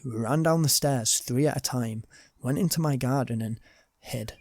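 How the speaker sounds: ordinary delivery, nothing marked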